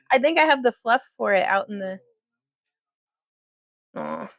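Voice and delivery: ordinary delivery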